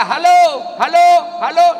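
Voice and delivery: loud, high halo called out into the receiver; shouting into phone. Retold or imitated. Old man yelling into a phone held upside down. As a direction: holler halo with no one answering. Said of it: "Teriaklah dia".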